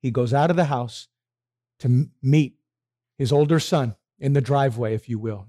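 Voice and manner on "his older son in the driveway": ordinary talk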